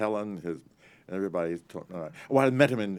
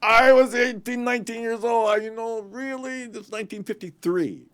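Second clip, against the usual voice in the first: excited; 12 dB louder than usual. Real, not acted. Telling the interviewer his age then, excited.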